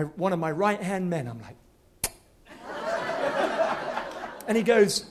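normal talking voice

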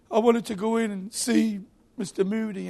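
put-on high-pitched upset child voice; little boy. Shut-out boy tells kind gentleman, high child voice. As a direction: whine it high like a small disappointed boy. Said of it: "And this little boy says".

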